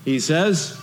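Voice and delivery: ordinary talk, nothing marked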